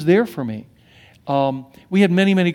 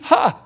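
high scoffing ha; single derisive laugh; mock laugh; 10 semitones higher than usual. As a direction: bark a mocking laugh. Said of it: "They just laugh".

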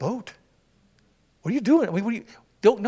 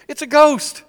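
"It's a ghost" cried out higher and louder, fear on ghost; cry out in fear; 9 semitones higher, 12 dB louder, slower than usual. Retold or imitated. Disciples crying out in fear at the figure on the water. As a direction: shriek "ghost" like frightened sailors. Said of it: "they cry out in fear".

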